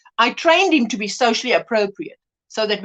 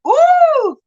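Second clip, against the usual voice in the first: loud, high-pitched ooh of disgust; 7 dB louder than usual. Real, not acted. Listing hated eating noises, high disgusted ooh.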